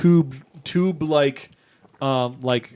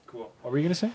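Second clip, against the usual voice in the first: ordinary talk, nothing marked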